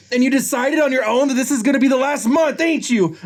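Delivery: high, melodramatic outburst; heated accusation. Acted. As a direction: accuse in a high, unbroken rush of feeling. Nick accusing in acted quarrel.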